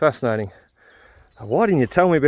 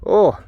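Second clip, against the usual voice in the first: sharp, higher, louder cry of pain; 6 semitones higher, 4 dB louder than usual. Real, not acted. Stabbing his hand on barbed wire, a pained oh. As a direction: yelp at the sudden jab. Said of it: "I'm more oohing 'cause".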